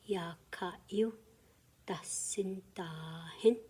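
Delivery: "No hui ta ya ka yu" split into slow separate syllables. Shown as a demonstration. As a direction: break "da sin ta hin" apart slowly, syllable by syllable. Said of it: "Say it with me".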